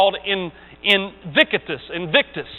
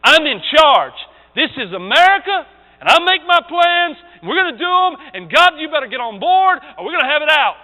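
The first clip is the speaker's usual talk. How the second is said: higher, louder swaggering braggart; preacher mocking a prideful man's boast, higher and louder; mock boastful man; 7 semitones higher, 4 dB louder than usual. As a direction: puff up like an arrogant boss defying God.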